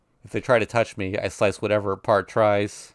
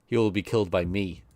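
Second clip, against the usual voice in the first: normal talking voice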